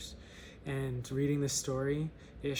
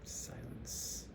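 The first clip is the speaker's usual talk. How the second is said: hushed narrator's whisper; 3 semitones lower, 12 dB quieter than usual. A narrator's reading. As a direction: whisper it softly as the story falls silent. Hushed pause at the story's punchline.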